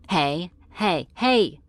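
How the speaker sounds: loud, rising warning; calling sharply to order. Acted. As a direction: cut off a screamer with rising volume. Captive starts screaming, demon scolds.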